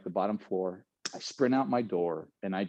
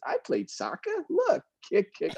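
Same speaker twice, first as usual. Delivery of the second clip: put-on high, sing-song tipsy girl showing off her kick; mock the drunk girl bragging; 11 semitones higher than usual. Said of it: "She goes".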